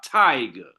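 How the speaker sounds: tiger with a strong ti- and a weak -ger; stress on first syllable. Shown as a demonstration. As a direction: hit the start of tiger, let -ger fall away. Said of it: "a stressed syllable followed by an unstressed".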